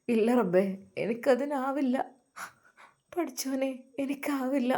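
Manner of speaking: fading into a hushed, broken prayer; tearful prayer. Acted. Maid crying alone to God in her room, softly tearful.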